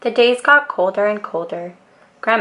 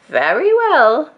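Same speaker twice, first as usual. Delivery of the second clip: Grandma Tildy; 7 semitones higher, 4 dB louder, slower than usual. A narrator's reading. Grandma agrees.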